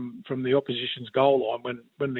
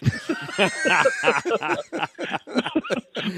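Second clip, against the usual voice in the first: hearty laugh; 8 semitones higher than usual. Real, not acted. Laughing at own story.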